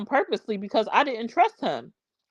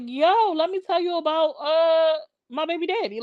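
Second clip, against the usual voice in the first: high-pitched, bursting to share the news; retold excited phone opener; eager gossip; 7 semitones higher than usual. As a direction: call up a girlfriend with juicy news. Retold excited phone call to girlfriend.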